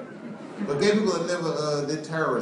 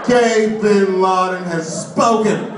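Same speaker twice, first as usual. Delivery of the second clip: very slow, drawn-out, higher and louder echo of the line; audience member echoing; 7 semitones higher, 7 dB louder, much slower than usual. Retold or imitated. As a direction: stand up and repeat it like a proclamation. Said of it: "this guy stands up, he goes".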